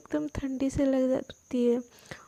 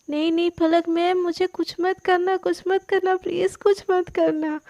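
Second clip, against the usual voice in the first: higher and louder, playful begging; mock pleading; 5 semitones higher, 5 dB louder than usual. A narrator's reading. Sana playfully begs Palak not to hurt her, higher and louder. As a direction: beg for mercy in jest.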